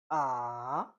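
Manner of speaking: Mandarin third tone on a long AH, falling then climbing. Shown as a demonstration. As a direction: drop AH down and swing it back up. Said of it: "You fall and rise".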